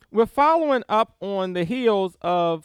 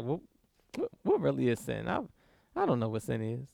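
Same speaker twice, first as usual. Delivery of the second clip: a hint of a puzzled sinner, much softer and lower; mock confused sinner; 9 semitones lower, 12 dB quieter, much faster than usual. Preacher voicing a sinner who claims not to know sin, softly puzzled. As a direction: mumble it like someone playing dumb about sin.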